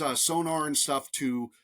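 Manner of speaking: ordinary talk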